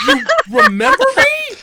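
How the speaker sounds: high, loud, laughing disbelief; surprised. Retold or imitated. Fan amazed the artist remembers him, high laughing surprise. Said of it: "I'm like".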